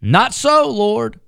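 shocked refusal of unclean food; Peter protesting. Acted. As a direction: recoil in horror at the idea. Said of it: "But Peter said".

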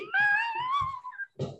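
very high wheezing squeal of laughter; helpless laughing squeak, held long. Real, not acted. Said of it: "I'm gonna do the squeak laugh".

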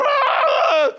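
high, loud mock stadium cheer; crowd roar. Preacher mimicking a stadium crowd's cheer, loud and laughing. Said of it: "all those people go".

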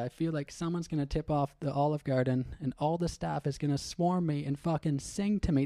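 usual speaking voice

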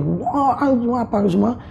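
high but held-back panicked cry; mock yell, pitched high; re-enacted yelling. Fleeing downstairs from the apparition, high mock yelling. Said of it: "teriak, Bang".

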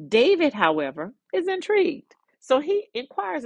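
preacher building curiosity; intrigued. A narrator's reading. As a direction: lift up high on "intrigued". Dramatic narration.

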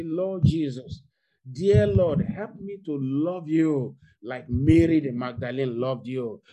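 loud, slow fervent prayer. Acted. Preacher leading congregation's prayer, loud and fervent. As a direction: call out the prayer with full force. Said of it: "I want you to say".

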